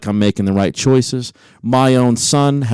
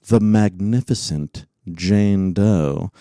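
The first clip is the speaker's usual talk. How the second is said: grand introduction; much slower than usual. Acted.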